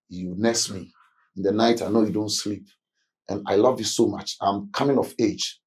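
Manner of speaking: soft, low praise for a father; a child softly thanking their father; tender child. Retold or imitated. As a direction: speak gently as a grateful son.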